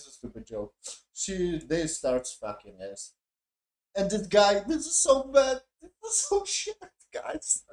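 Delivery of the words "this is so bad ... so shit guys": high, squeaky talk through laughter; cracking up. Real, not acted. Reviewer telling viewers how bad the show is, cracking up. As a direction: barely get it out for laughing.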